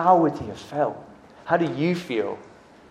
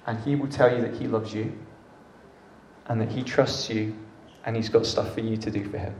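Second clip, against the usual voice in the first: tender close; 4 semitones lower, 9 dB quieter than usual. Real, not acted. Closing soft words.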